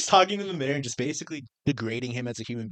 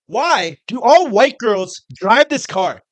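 slow, loud, higher incredulous rant; 6 semitones higher, 6 dB louder, slower than usual. Real, not acted. Complaining to a friend about jeep owners, slow incredulous rant. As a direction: drag out the disbelief, louder and higher. Ranting about jeeps and duckies.

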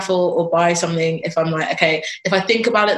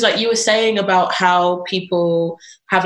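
ordinary talk, nothing marked

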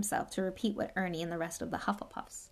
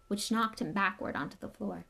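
ordinary delivery, nothing marked

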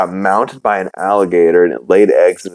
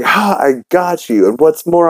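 mock triumph, pitched up high; spring the trap in a raised voice; triumphant gotcha; 8 semitones higher than usual. [name] catching Nigel after his confession, triumphant gotcha.

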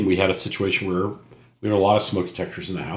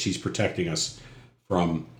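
ordinary delivery

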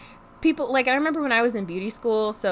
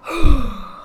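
long heavy exasperated sigh; a big defeated exhale; loud resigned sigh; 4 semitones higher, 9 dB louder than usual. Real, not acted. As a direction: let it all out in one sigh. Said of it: "So I'm just like".

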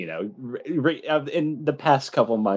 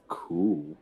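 deadpan cool; 6 semitones lower, 5 dB quieter than usual. Acted. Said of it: "and thinks".

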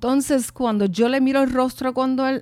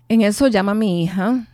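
ordinary delivery

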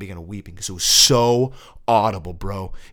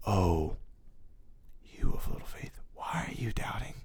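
tender whisper; 5 semitones lower, 11 dB quieter than usual. Retold or imitated. God whispering his name to him on the floor, soft whisper. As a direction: whisper it close, gently. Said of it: "this is the tone of God".

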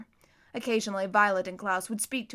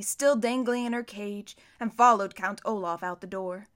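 plain reading voice

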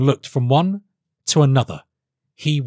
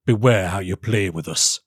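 low, stern royal warning; menacing king's caution; sharp; 4 semitones lower than usual. Acted. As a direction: warn him sharply in a lower voice. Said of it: "said the king sharply".

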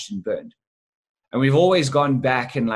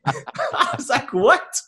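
laughing disbelief; 11 semitones higher, much slower than usual. Retold or imitated. Stunned reaction retold laughing.

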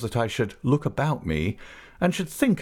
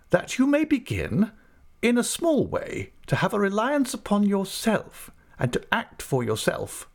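brisk, much higher voice of a stern aunt; 11 semitones higher than usual. Acted. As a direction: play the decisive old aunt. Aunt speaks, voiced high.

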